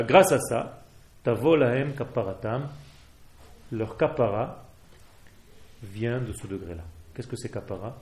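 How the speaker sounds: normal talking voice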